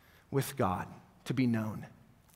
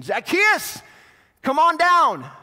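loud, high call up into a tree; 11 semitones higher, 8 dB louder, slower than usual. Acted. Jesus calls Zacchaeus down from the sycamore, loud and high. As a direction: shout up to the man in the branches.

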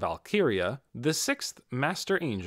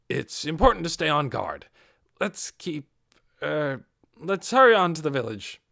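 a louder, higher earnest leader; earnest Peter; 5 semitones higher, 6 dB louder than usual. Acted. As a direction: push the group on with forced cheer. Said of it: "Peter said".

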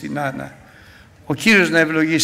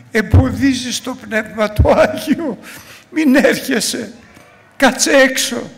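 scolding sister; 11 semitones higher, 7 dB louder than usual. Retold or imitated. Voicing a sister's rebuke.

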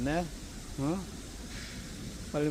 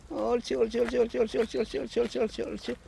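talking to a dog; 10 semitones higher, faster than usual. Real, not acted. Praising dog repeatedly. (강아지를 높은 목소리로 칭찬하기)

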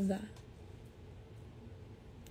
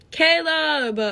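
higher, louder happy call; 7 semitones higher, 6 dB louder than usual. Real, not acted. Greeting a viewer in the chat, calling out. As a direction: call the name out brightly.

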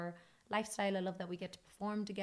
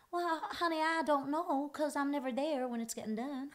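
sassy Tennessee twang; 6 semitones higher than usual. Retold or imitated. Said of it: "impression on [name]".

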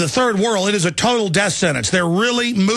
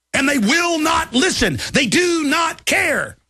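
much higher real outburst, slowing on "They do not care"; furious rant; 10 semitones higher, slower than usual. Real, not acted. Host ranting to listeners, angry high outburst. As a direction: cry it out high in exasperation.

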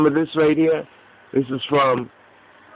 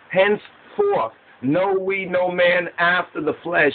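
slow, raised-pitch declaiming with pauses; scripture recitation; 4 semitones higher, slower than usual. Acted. Preacher explaining that one died for all, emphatic recitation. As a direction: preach the verse phrase by phrase.

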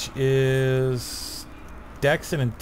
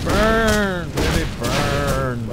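gleeful battle cry; 3 semitones higher, 4 dB louder, much slower than usual. Real, not acted.

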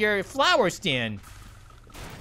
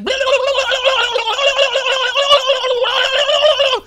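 high, loud bleating goat babble; talking goat noise; 14 semitones higher, 8 dB louder than usual. Pretend. Imagining the freaking-out goat talking, high loud bleat. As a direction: let loose a frantic goat jabber. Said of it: "that's what he would sound like if he was talking".

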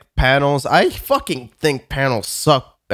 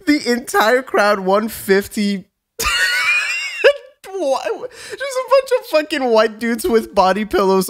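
high-pitched, helpless laughter through the words; laughing while talking; 9 semitones higher than usual. Real, not acted. Telling friends about the crowd, real laughter. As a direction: barely get the story out for laughing. Cracks up telling the panel story.

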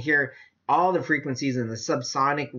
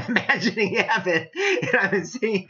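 laughing; 5 semitones higher than usual. Real, not acted. Preacher imagining heaven with his congregation, laughing. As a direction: laugh as the picture of heaven takes over.

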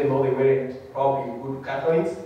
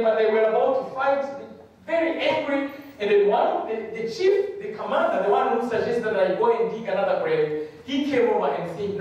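usual speaking voice

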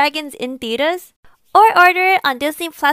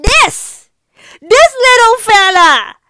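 loud, drawn-out adoring emphasis; excited gushing; 6 semitones higher, 7 dB louder, slower than usual. Real, not acted. Reviewer shows off favorite little character, loud drawn-out gushing. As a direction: raise pitch and stretch it out fondly.

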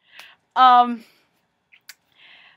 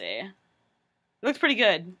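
ordinary talk, nothing marked

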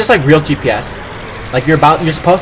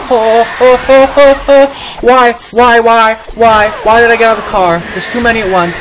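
panicked shouting; 8 semitones higher, 7 dB louder than usual. Real, not acted. Attacked by many zombies.

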